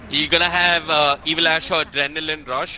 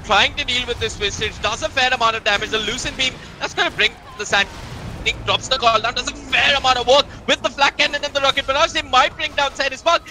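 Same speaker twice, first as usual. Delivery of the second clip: rising esports caster excitement; excited play-by-play; 7 semitones higher than usual. Acted. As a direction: call the fight like the crowd is on its feet. Caster calls the team fight.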